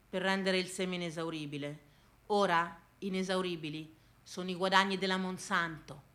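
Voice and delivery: low, soft, sombre telling; quiet, low-pitched solemnity; grave, hushed. Acted. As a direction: drop right down and tell it gravely. Grave aside about farmers' deaths.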